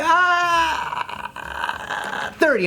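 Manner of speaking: mock reluctant seller drops the price; vendor giving in.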